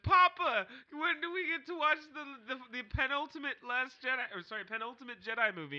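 high, loud mock kid voice; imitated child. Co-host plays future kid asking papa, child voice. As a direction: pipe up like a pleading kid. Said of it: "Whenever you have kids, they're gonna be like".

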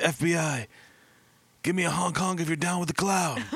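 high, bossy agent at the door; FBI agent voice. Retold or imitated. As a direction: bark it like a raiding cop. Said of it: "They knocked on the door like".

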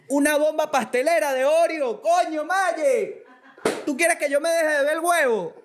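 high, squealing exclamation; finding an Oreo bomb in the bag, squealing high; shocked. Real, not acted. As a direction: shriek with delighted disbelief.

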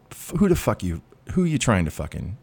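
normal talking voice

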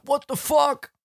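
high, stunned what the fuck; mock outraged commenter; shocked outrage.

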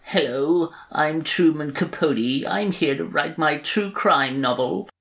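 high, nasal-free lilting Capote voice; Truman Capote impression. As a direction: do Capote's high, slow drawl. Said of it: "he's got that kind of".

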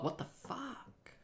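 slow, high whispered what the fuck; stunned disbelief. Retold or imitated. Imitating everyone's reaction to the news, slow stunned whisper. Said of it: "Everyone's like".

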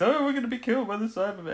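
high-pitched mock wail of dread; nervous panic. Host voicing nervous crew fearing Cybermen, mock panic. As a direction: imitate a worrier sure of doom. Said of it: "So old nervous Viner has to stay".

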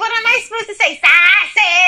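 high, loud indignant protest, exploding on "Sausage!"; hammed-up indignant shout; mock outrage. Mock outrage at being teased.

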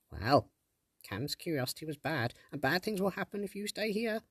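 high, pleading sprite; pleading fairy. Acted. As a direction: beg like a tiny fairy. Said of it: "the sprite pleaded".

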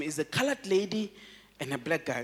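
ordinary talk, nothing marked

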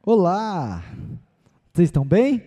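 calling out to the crowd; loud greeting. Real, not acted. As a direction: greet the whole room loudly. Greeting the congregation loudly.

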